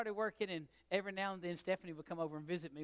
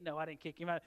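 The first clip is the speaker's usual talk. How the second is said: normal talking voice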